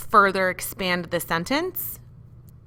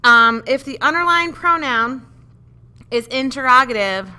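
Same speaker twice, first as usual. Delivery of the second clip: louder and higher over the noise; raised voice over bell; 6 semitones higher, 8 dB louder than usual. Real, not acted. Teacher reading directions loudly over a ringing bell. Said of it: "Oh, look, there's a bell".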